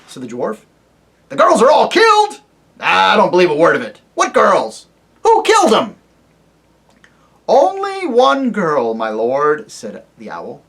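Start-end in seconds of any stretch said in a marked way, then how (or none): 1.3-6.0 put-on high, loud querulous old dwarf; deaf old dwarf. Deaf dwarf to the owl, loud querulous old man. 7.5-9.7 high, loud hooting owl voice. Acted. Owl to the deaf dwarf, high hooting call.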